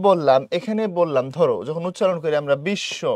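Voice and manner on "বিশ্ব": বিশ্ব said with a doubled sh, "bish-sho". Shown as a demonstration. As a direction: hold the sh long in বিশ্ব, no v sound. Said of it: "এই বিশ্ব বলার সময় তালব্য শ double হয়ে গেছে".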